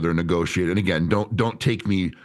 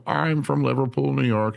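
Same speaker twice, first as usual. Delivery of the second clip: higher-pitched, casual voice of an employee; Ricky chatting; 7 semitones higher than usual. Retold or imitated. New hire answering the trainer's small talk, casual put-on voice. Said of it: "I was like, "So, Ricky, where are you from?".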